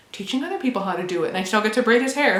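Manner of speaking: ordinary delivery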